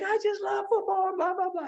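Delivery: high-pitched imitation of a little woman. Tiny star greeting football players, high put-on voice. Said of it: "here comes this little teeny girl".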